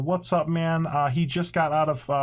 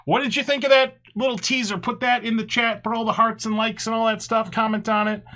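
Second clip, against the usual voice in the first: upbeat host voice; 9 semitones higher than usual. Acted. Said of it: "host greeting the audience".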